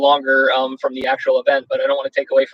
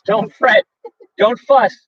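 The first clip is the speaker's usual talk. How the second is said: higher, soothing little mouse voice; squeaky mouse; 12 semitones higher, slower than usual. Acted. Mouse calming the upset bear, high squeaky. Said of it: "Mouse squeaks".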